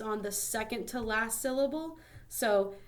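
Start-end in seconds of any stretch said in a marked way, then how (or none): none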